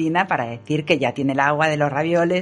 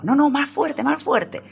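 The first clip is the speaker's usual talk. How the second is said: mock director; 9 semitones higher, slower than usual. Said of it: "el director le dijo".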